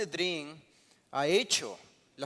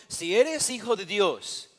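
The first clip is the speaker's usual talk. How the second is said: slow, loud, higher-pitched challenge; lightly voiced devil; 6 semitones higher, 9 dB louder, slower than usual. Preacher quoting the devil tempting Jesus on the temple, lightly acted loud challenge. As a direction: quote the tempter with a raised, drawn-out dare. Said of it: "el diablo le dijo".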